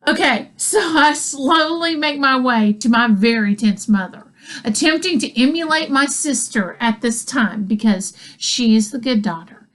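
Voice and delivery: ordinary delivery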